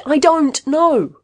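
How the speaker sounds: slow, high, loud outburst; raging. A narrator's reading. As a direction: rage it out, stretching each word. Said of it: "Caswell raged".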